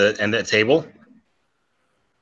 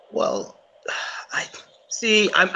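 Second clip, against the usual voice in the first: whispery sigh; 6 semitones higher, 5 dB quieter, slower than usual. Acted. Sighing hesitation.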